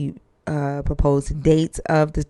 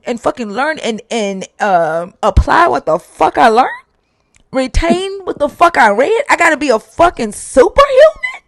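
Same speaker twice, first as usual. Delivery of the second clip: high, heated disbelief; indignant outburst; 10 semitones higher, 7 dB louder than usual. Retold or imitated. Founder fuming at the investor's remark, heated outburst. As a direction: let the outrage rise. Speaker's indignant inner reaction.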